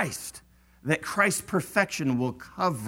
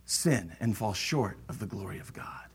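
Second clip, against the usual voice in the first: sudden whisper; hushed; 8 semitones lower, 8 dB quieter than usual. Real, not acted. Preacher whispering to his congregation. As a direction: drop to a whisper for weight. Preacher drops voice mid-sermon.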